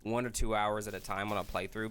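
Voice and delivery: ordinary talk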